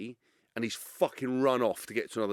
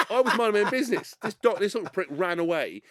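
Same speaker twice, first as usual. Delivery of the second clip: higher, louder, quick; indignant protest; 6 semitones higher, 4 dB louder than usual. Retold or imitated. Complaining about the tabloid story, exasperated. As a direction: rattle off his defence in exasperation.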